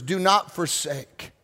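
slow it down on the repeat; slow, weighty repeat. Shown as a demonstration. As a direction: Do not forsake said slowly, each word weighted. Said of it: "repeated slowly".

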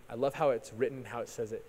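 ordinary delivery

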